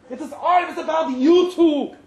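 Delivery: put-on scornful German accent. German boss sneering at Thomas, "you two" said crisply. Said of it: "the scorn in the last remark".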